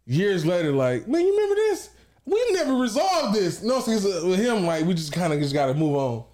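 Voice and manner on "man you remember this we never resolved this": high-pitched, playful challenge; mock confrontation. Asking his brother years later, high mock-indignant voice. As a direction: raise the pitch as if reopening an old argument.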